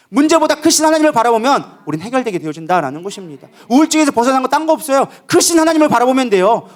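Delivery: high-pitched, driving sermon delivery; fervent preaching. Real, not acted. Preacher warns congregation about being trapped in problems, fervent preaching.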